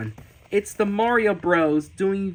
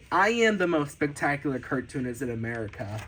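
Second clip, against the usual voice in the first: fast, low-key run-through of a boast; hurried, lower throwaway version; quick repeat; 5 semitones lower, 3 dB quieter, faster than usual. Pretend. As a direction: rattle the boast off quickly, dropping away. Same boast repeated quickly afterwards.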